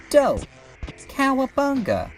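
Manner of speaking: high, punchy mock-teen catchphrases; hyped slang exclamation.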